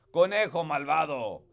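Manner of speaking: "Conejo malvado" read out in Spanish; Spanish expression. Shown as a demonstration. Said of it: "some Spanish expressions".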